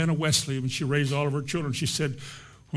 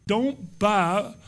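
ordinary delivery

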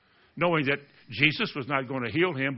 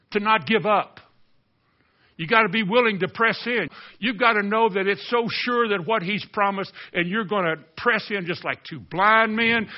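raised, insistent preacher voice; impassioned preaching; 5 semitones higher than usual. Real, not acted. Preacher urging the congregation not to give up praying, impassioned preaching. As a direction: press it home to the congregation.

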